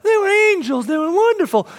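put-on sing-song praise of the kids; a hint of the babysitter gushing; sweet babysitter report.

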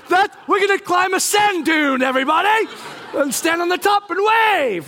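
high, sarcastic hurrah; rally everybody with mock gusto; mock rallying shout. Leader mocking a lightning warning, gung-ho shout.

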